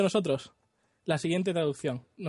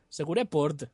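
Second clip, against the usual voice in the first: put-on half-sung joke Portuguese accent; mock Portuguese accent; 3 semitones higher, 7 dB louder than usual. Reading the Portuguese dub to friends, light Portuguese accent. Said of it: "pone el acento y todo".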